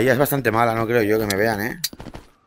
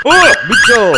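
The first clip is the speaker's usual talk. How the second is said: loud jump-scare cry; startled yell; 8 semitones higher, 8 dB louder, slower than usual. Real, not acted. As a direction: yelp as if something leapt at you. Monster jumps out in game.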